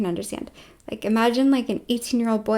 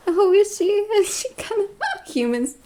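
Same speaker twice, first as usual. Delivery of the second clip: mock worry; 8 semitones higher than usual. Mock alarm about female rival.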